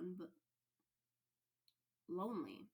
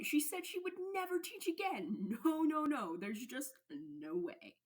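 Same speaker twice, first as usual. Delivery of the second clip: panicked denial; 2 semitones higher, 6 dB louder than usual. Acted. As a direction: deny it more frantically with every no. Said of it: "noticed his mumbling".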